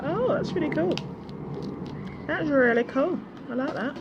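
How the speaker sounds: high, loud thrilled gushing; excited gush. Retold or imitated. Reacting to the bottles, a high excited gush. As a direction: gush over the bottles like a delighted kid. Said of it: "I was like".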